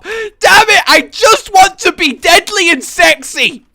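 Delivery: high, loud, wailing tantrum; mock anguished rant. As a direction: yell a hammed-up lament at fate. Mock tantrum over failed creepy voice.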